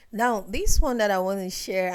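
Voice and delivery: normal talking voice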